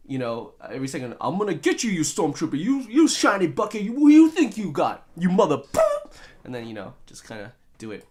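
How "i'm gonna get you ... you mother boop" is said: mock action-hero tirade, building louder to a censored curse; hammed-up angry trash talk; Mace Windu. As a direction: rant at the enemy, swinging up in pitch and volume. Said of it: "where Mace Windu is, like, saying".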